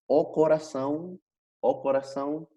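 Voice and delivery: "o coração" with a nasal -ão ending, rising; Portuguese pronunciation. Shown as a demonstration. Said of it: "en portugués es". ("o coração" pronunciado en portugués, con final nasal)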